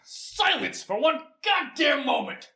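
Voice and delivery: high, slow furious yell; angry shout. Acted. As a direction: roar him into silence. Host snaps at cohost.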